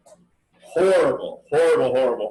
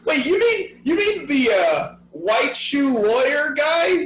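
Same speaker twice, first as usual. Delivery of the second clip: high, laughing disbelief; laughing surprise; 9 semitones higher than usual. Real, not acted. Podcaster realizing which candidate is meant, laughing surprise. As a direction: blurt it out as it dawns on you.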